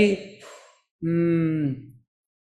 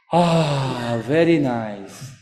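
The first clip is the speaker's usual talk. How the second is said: mock relief; 5 semitones lower, much slower than usual. Beaten man relieved for an hour.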